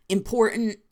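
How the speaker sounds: IMPORTANT with the second T swallowed; dropped middle T. Shown as a demonstration. Showing New Jersey speech, middle T not pronounced. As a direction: say "impor'nt", T caught in the throat. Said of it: "we don't pronounce T's in the middles".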